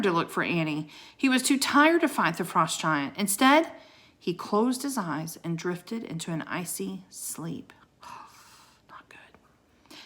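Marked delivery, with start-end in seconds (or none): 4.2-7.7 hushed whisper, fading off; whispered narration. A narrator's reading. Narrator whispering as Jack falls asleep in snow, hushed. Drifting into icy sleep. 8.0-10.0 breathy whisper to the listener; whispered aside. Real, not acted. Reader's aside to the child listening, whispered. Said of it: "aside to listener".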